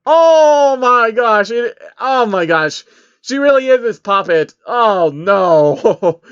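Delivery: high, loud, drawn-out shocked shout; real disbelief; shocked outburst. Real, not acted. The reader shouts at the story twist, real shock.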